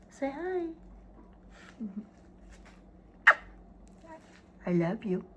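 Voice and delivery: high soft pet talk; talking to a dog. Real, not acted. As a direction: coax the dog gently.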